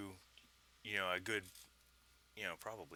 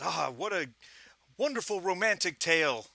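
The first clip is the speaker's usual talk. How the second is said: higher-pitched, hearty colonel's exclamation; boisterous British officer; 8 semitones higher, 4 dB louder than usual. Acted. Lieutenant Colonel Smythe praising the tale, boisterous and hearty. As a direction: gush about the American West like a jolly officer. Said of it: "rather boisterously, you know, raises his voice".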